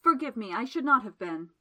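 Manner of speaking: embarrassed man pleading loudly; blurted. A narrator's reading. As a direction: blurt the apology, flustered. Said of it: "he blurted".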